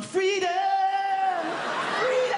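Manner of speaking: high, loud cry of freedom; jubilant cry. Acted. Parent celebrating a moment without the children, jubilant cry. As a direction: belt it out in mock triumph.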